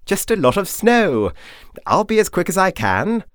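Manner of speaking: a high, loud, breezy brush-off; cheerful Doctor. Acted. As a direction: brush off her worry brightly. Doctor reassures Victoria.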